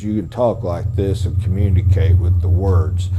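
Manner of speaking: low monotone, words only; uninflected low drone; no voice inflection. Shown as a demonstration. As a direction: drop low and drain out all the inflection. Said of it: "not having the proper voice inflection".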